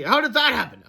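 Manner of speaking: acted surprise; surprised cry. A narrator's reading. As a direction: exclaim in bewilderment. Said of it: "cried Fluffy".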